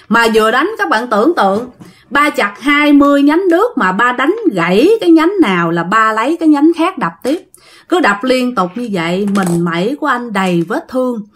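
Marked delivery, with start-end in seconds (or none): none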